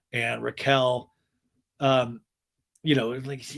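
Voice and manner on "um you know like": usual speaking voice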